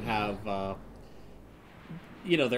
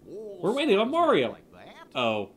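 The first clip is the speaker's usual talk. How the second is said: cartoon villain voice; 8 semitones higher, 3 dB louder, slower than usual. Voicing Koopalings waiting for Mario.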